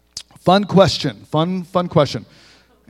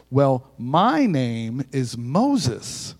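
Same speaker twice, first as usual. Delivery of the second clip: slow, low, odd parrot voice; the parrot answering the thief, slow and low; 4 semitones lower, 5 dB quieter, much slower than usual. Acted. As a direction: drawl it out like a deadpan bird.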